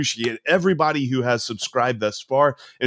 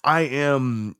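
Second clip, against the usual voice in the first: ordinary talk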